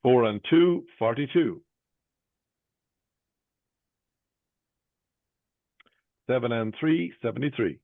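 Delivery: sing-song bingo number calling; bingo caller. Real, not acted. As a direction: lift the first half, drop the second.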